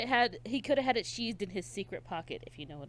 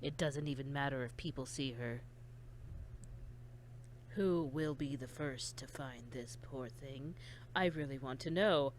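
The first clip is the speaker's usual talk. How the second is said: creepy quiet voice; 5 semitones lower, 9 dB quieter than usual. Acted. Voicing the killer reading the lines.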